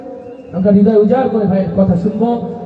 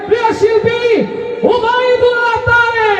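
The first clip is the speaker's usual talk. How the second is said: much higher, drawn-out announcer call building up; rousing introduction; 11 semitones higher, much slower than usual. Real, not acted. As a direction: hype the crowd for the singer. Announcing singer, building to chant.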